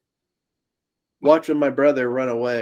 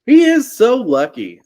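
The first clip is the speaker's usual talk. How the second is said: slow, louder, stressing so; a slowed, louder insistence; emphatic; 8 semitones higher, 8 dB louder than usual. Real, not acted. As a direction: lean hard on so lucky. Emphatic about brother surviving.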